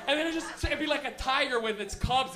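usual speaking voice

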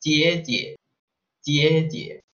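"jie, jie" in Mandarin tones; Chinese word. Shown as a demonstration. Teacher teaching the word for older sister. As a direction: model "jie, jie" for learners.

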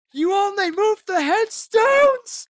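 high, loud outraged shout; yelling in character. Retold or imitated. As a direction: yell it at the contractor, stretching the last words. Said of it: "yelling at the contractor".

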